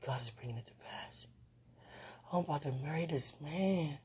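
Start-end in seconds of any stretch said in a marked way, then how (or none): 0.1-1.3 soft prayerful whisper. Real, not acted. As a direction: breathe it like a prayer. Whispered faith.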